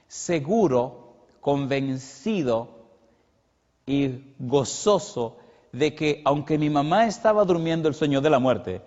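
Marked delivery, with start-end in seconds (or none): 0.1-6.2 slow, each word weighed and stressed; drawn-out affirmations, one by one; emphatic slow words. Acted. Preacher telling his flock how he felt, slow and stressed. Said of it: "Cómo usted cree que yo me sentía".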